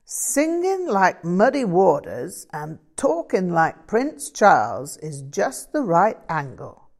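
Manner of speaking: loud American blues character voice; musing that builds into emphatic American slang delivery; enthusiastic American bluesman. Acted. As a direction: pitch it up like an excited bluesman.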